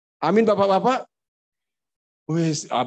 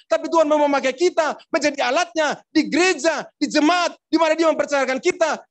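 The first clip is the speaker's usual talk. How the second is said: raised, emphatic pulpit voice, slowing on value; fervent preaching; 8 semitones higher than usual. Acted. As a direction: preach it out to the congregation. Preaching climax. (berkhotbah dengan semangat)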